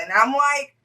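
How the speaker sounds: ordinary talk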